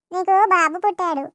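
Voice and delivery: normal talking voice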